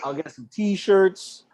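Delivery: usual speaking voice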